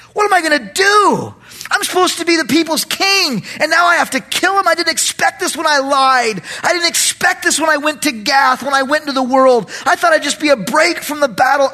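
high-pitched, hammed-up desperation; panicked. Preacher voicing David's thoughts, panicked. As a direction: fret at the top of your voice. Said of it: "David must have thought".

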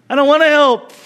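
a laughing mock-reluctant admission. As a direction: laugh it out like a confession. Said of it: "some of you are thinking".

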